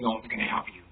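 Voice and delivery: very soft, low demon caller; hushed, low creepy threat. Retold or imitated. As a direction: imitate the eerie phone voice. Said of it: "He heard the call saying".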